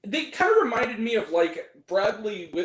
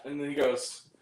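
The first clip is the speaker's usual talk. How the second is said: usual speaking voice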